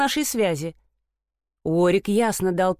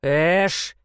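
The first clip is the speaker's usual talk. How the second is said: growl; 3 semitones lower than usual. Acted. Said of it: "прорычал Уорик".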